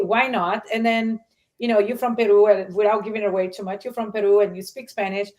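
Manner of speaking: ordinary talk, nothing marked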